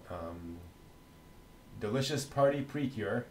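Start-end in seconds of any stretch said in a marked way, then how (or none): none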